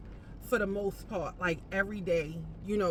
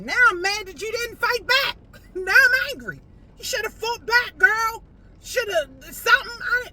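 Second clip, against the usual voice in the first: high, loud comic indignation; hammed-up fury at no fight back; shouting at the imagined boss; mock anger; 11 semitones higher, 9 dB louder than usual.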